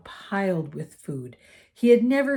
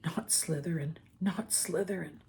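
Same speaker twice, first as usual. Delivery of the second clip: softer, inward pleading to himself; silent plea; 8 dB quieter than usual. A narrator's reading. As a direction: beg it under your breath.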